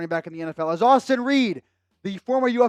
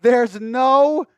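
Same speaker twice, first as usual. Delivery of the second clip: stunned disbelief; 3 semitones higher, 6 dB louder, much slower than usual. Real, not acted. Stunned after the donation.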